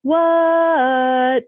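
high, lilting eerie reply; child answering back in the ghost's creepy tune; same sing-song voice. Retold or imitated. Toddler answering the ghost in its eerie sing-song. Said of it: "I answered in the same voice".

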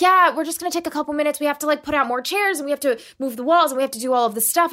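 high, rushed, bubbly rattle; high-pitched mock chirpy hurry; super-excited imitation. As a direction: reel it off like an eager organizer. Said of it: "she's super excited".

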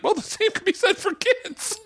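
high-pitched, squeaky laughing delivery; laughing retort. Real, not acted. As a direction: say it through rising laughter. Cracking up at own retort.

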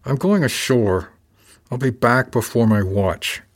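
slow, tight, barely held-in anger; through clenched teeth. A narrator's reading. Burnside announces he's going ashore, trying not to explode. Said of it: "said through clenched teeth".

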